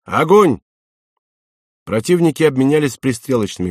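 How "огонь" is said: raised, higher-pitched firing order; acted officer's shout; shouted command. A narrator's reading. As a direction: call the guns to fire. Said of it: "опустил бинокль и крикнул".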